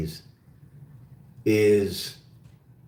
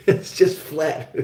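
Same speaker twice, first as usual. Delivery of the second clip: laughing through the words, slow and higher; much slower than usual. Real, not acted. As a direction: chuckle while you say it, drawing it out. Joking about flat Oklahoma landscape.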